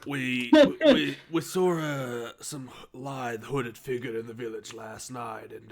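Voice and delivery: usual speaking voice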